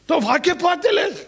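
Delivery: high, loud plea to wait; eager calling. Acted. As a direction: call him back eagerly. Buyer pleading to wait.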